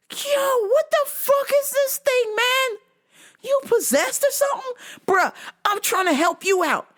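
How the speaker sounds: high-pitched incredulous outburst; alarmed protest. Retold or imitated. Owner to crazed cat, high incredulous protest. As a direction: squeal in disbelief at the cat. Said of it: "I was like".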